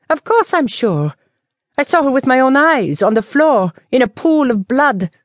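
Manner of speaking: put-on high, rushed, agitated old woman; frightened village woman spilling the news; panicked spinster. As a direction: blurt it out breathlessly in panic. Emilienne insisting, dramatic.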